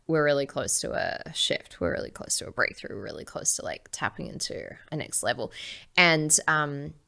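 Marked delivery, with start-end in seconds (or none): 0.1-5.5 much lower and softer, breathy near-whisper; hushed self-talk. Retold or imitated. Speaker showing what she tells herself in hard moments, hushed and soft. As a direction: murmur it to yourself to stay calm.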